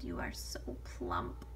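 low, slow sly wolf. Acted. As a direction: purr it like a hungry wolf. Wolf voices granny in whisper.

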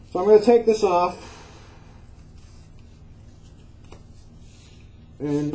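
muffled behind a face respirator; speaking inside a half mask; through a respirator mask. Real, not acted. Seen 4: Dual-cartridge half-face respirator covers his mouth and nose throughout the labelled words.

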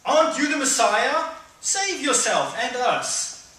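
high, loud taunting sneer, slow on the second line; hurled insults. Acted. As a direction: jeer at him from the cross. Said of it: "hurled insults at him".

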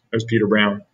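usual speaking voice

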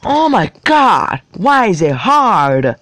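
high, slow frustrated whine; frustrated groaning. Real, not acted. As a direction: groan it out, fed up. Kid stuck in a hard game level.

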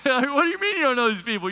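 loud, high, fast mock disbelief; incredulous. Guest to host about the strangers, incredulous. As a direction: act out a baffled guest, voice shooting up.

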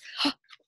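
sharp breathy gasp of delight; surprised gasp. Real, not acted. Teacher reacting to a new page for children, gasping.

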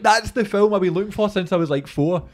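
loud, high-pitched eureka outburst; excited realisation. Retold or imitated. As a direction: blurt it out like a long search just ended. Acting out his moment of discovery.

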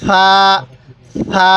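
long, gentle lisped "th" opening সা; soft সা. Shown as a demonstration. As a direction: say সা softly with the tongue at the teeth, like "th" in "thin". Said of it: "এরকমভাবে উচ্চারণ করবেন".

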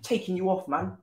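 usual speaking voice

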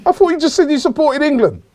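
very high-pitched disbelief; incredulous protest. Real, not acted. Host challenging co-host's support for England, high and incredulous. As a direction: voice leaping up in baffled protest.

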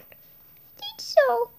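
tiny hesitant voice; timid small voice. Acted. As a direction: whisper it like a scared little kid. Tiny character answers softly.

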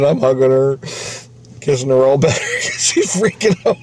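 ordinary talk, nothing marked

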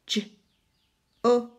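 normal talking voice

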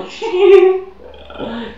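high burst of laughter; loud laugh. Real, not acted. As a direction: crack up laughing.

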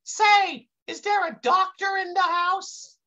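put-on high kid voice calling for help; calls out. A narrator's reading. Kid at the office door seeking a doctor, high call. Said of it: "calls out".

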